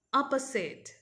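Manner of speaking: "opposite" as "OP-uh-sit", falling at the end; model pronunciation. Shown as a demonstration. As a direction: say "opposite" with a short final i. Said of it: "and then listen".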